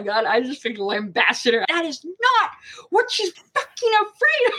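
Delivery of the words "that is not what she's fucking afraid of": high, indignant reenacted yell; mock-furious shout; retold angry outburst. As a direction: tell off an intruder in a high voice. Acting out outburst at the doctor.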